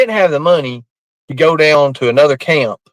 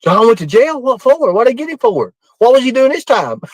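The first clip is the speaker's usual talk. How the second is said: pitched-up surprised questions; mock surprise; 8 semitones higher than usual. [name] learning John Paul went to jail, high surprised questions. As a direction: ask in disbelief.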